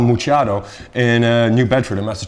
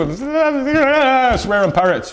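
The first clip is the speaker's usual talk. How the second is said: laughing; 13 semitones higher, much slower than usual. Real, not acted.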